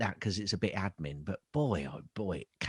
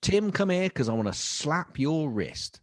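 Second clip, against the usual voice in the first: mock scolding; 6 semitones higher, 3 dB louder than usual. Scolding Tim mockingly.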